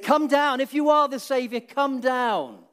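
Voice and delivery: high, loud mocking call; jeering shout. Crowd taunting Jesus on the cross, loud jeering call. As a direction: taunt him to come down from the cross. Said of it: "people jeered and said".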